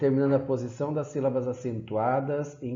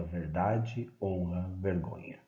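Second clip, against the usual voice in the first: final words dropped low and soft; poem recital, closing; 4 semitones lower, 6 dB quieter, slower than usual. Acted. Teacher ending the poem recital, soft and low. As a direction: let the last three words fall away quietly.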